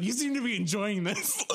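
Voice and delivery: high, teasing mimicry; mock accusation. Storyteller mimicking the co-host's accusation, high and teasing. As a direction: put it in a high mocking voice. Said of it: "You're like".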